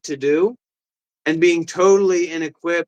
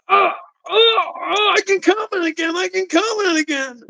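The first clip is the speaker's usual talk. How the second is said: much higher squealing mock glee; mock excited chatter; 13 semitones higher than usual. Streamer mocking fans who rush to comment, high squeal. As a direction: squeal like an eager fan.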